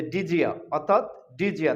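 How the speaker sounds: "ডিজিয়া" with d and y fused into j; linked pronunciation. Shown as a demonstration. Teacher gives the resulting pronunciation, "ডিজিয়া" with a j link. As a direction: run it together as di-jia, "ডিজিয়া". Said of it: "এটা আমরা উচ্চারণ এইভাবেই করব".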